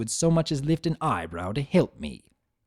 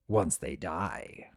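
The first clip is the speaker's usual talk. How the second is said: even quieter, slow and savoring; softer menacing murmur; 7 semitones lower, 7 dB quieter, slower than usual. Acted. Discord repeating the threat, softer and slower. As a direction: let it die away.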